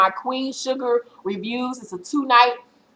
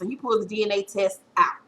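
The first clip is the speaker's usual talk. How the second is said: normal talking voice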